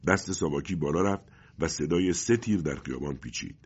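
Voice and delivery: plain reading voice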